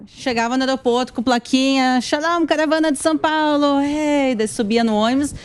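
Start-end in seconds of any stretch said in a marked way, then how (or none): 2.0-4.3 bright, slightly raised welcome to a tour group; cheery tour guide greeting. Retold or imitated. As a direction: greet the arriving group like a guide holding a sign.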